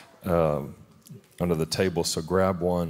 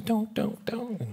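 squeaky high imitated "don't" getting softer; mock pleading; 5 dB quieter, slower than usual. Imitating a woman begging him not to, squeaky high. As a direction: mimic her high, fading protest. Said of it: "She's going".

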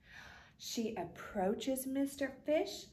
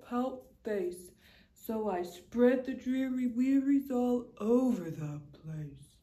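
glum pout fish; 3 dB louder than usual. Acted. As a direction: sink into the gloom of a sulky fish. Fish answers jellyfish glumly.

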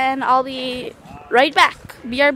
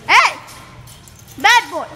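loud, sharp telling-off of a naughty dog; scolding shout; 9 dB louder, slower than usual. Real, not acted. Owner scolding her disobedient dog, loud angry shout. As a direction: yell at the dog for misbehaving.

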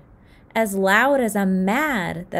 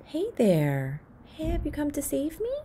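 hopeful question; 7 semitones higher, 3 dB quieter than usual. Acted. Talking to the cat.

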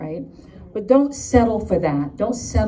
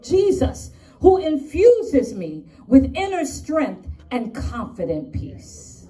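New York attitude; 6 semitones higher, 4 dB louder than usual. Shown as a demonstration. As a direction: punch each phrase with New York swagger. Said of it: "say it with my New York attitude".

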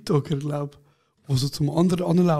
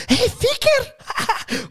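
much higher, slow teasing call; sing-song it from the side; mock call-out; much slower than usual. Young women teasing him from across the street.

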